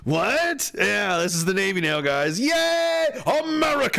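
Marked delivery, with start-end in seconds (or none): 2.4-4.0 mock patriotic cheer.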